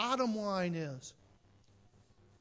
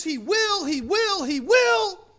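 high, loud repeated insistence, punching each WILL; insistent shouting; 12 semitones higher, 12 dB louder, slower than usual. Acted. Preacher mimicking insistent believers, high emphatic shouting. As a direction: shout it over and over, building at the end.

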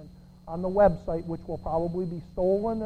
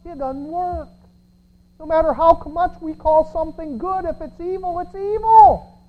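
loud, high preaching climax; fiery preaching; 10 semitones higher, 11 dB louder than usual. Acted. Preacher rebuking a congregation, loud fiery preaching. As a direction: thunder it from the pulpit.

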